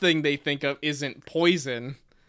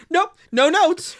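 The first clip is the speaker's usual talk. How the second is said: higher, louder, slower sing-song take; imitating own blurt; 13 semitones higher, 9 dB louder than usual. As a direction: replay your own quick remark, hammed up. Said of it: "just me going".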